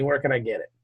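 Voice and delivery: ordinary talk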